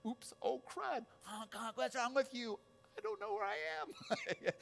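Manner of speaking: high-pitched flustered yelping; hammed-up frantic confusion; mock panic. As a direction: squeal as a wrong-way driver. Acted wrong-way driving panic.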